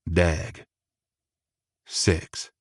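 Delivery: plain reading voice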